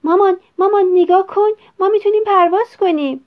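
put-on high, loud chirping boy; bird-like child's squeal; chirpy little bird. A narrator's reading. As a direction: pipe up excitedly like a sparrow calling mum. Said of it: "با صدای جیک جیک مانند گفت".

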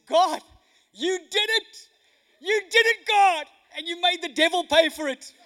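high, full-voiced exultant shout; triumphant praise. Widow thanking God despite the atheist's claim, triumphant shouted praise. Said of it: "raised her hand one more time and said".

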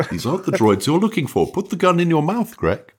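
lightly put-on Jedi mind-trick voice; a hint of a film mentor commanding a weak mind; Obi-Wan. Player using telepathy on Greg, lightly voiced Jedi persuasion. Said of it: "I'll do my Obi-Wan voice".

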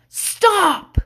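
high, drawn-out yelled word; imitating toddler's yell. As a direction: yell it like a little kid. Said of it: "The baby started to yell".

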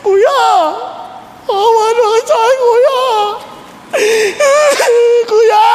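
hammed-up tearful begging; Joseph wailing. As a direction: sob and shout for big brother. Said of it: "iyak na iyak si Joseph".

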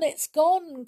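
put-on girl trailing off, near tears; tearful, fading. A narrator's reading. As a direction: let it sink in and trail off sadly. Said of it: "almost in tears".

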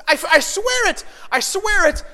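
high, loud, emphatic vow; insistent swearing. Retold or imitated. Wife insisting to husband she felt the baby move, adamant. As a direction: imitate a wife desperately insisting she is right. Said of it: "Jen was swearing high and low".

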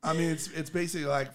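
normal talking voice

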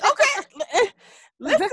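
high, breaking up with laughter; laughing. Real, not acted. Host cracking up over her own story, laughing. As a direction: laugh through the words.

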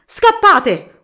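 high, loud panicked yell; terrified shout. Acted. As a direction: scream at everyone to run. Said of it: "urlarono i gitani impauriti".

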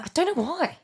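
high-pitched put-on Australian accent. Shown as a demonstration. Host answering the Margot Robbie remark in an Australian accent. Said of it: "You sound like Margot Robbie there".

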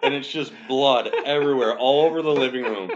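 telling it through laughter; laughing at the bloody memory. Real, not acted. Laughing while telling bloody story.

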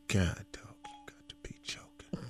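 breathy hushed whisper to his friend; whispered aside. Retold or imitated. Whispering to his boy as the ex approaches, hushed. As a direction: whisper it so she won't hear. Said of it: "retold club encounter, hushed aside".

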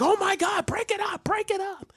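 very high mock-panicked squeal; panicking lunch ladies. Lunch ladies rush over to stop the fight, high panicked squeal. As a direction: squeal like alarmed onlookers stepping in. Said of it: "the lunch ladies are coming over".

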